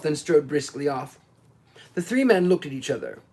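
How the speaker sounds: usual speaking voice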